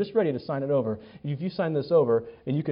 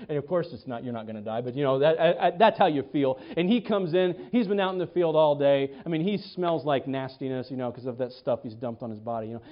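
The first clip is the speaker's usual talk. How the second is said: usual speaking voice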